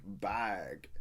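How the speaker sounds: put-on a lightly drawled Valley Girl "Bag.". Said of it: "Like, she'll be like".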